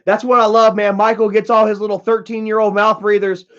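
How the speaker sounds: normal talking voice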